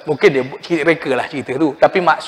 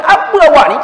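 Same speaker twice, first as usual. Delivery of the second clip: high, loud snap back; hammed-up mother scolding; mock angry mother; 12 semitones higher, 6 dB louder than usual. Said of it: "Mak dia marah".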